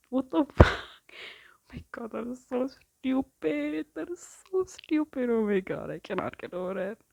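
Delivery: high, loud, drawn-out disbelief; incredulous laughing outburst. Real, not acted. Reader can't get over the stupid line, incredulous squealing.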